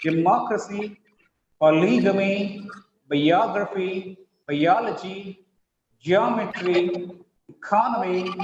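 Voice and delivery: slow model list, each stressed on the second syllable: "Democracy, polygamy, biography, biology, geometry, economy"; stress before the ending. Shown as a demonstration. Teacher modelling words for participants, "Democracy" to "economy" stressed on the second syllable. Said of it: "if you give stress on more".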